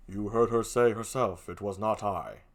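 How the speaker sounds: low, soft, grave figure; Othello. Acted. Othello denies it to Emilia, low and guarded. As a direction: keep it deep and hushed. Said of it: "Here's Othello".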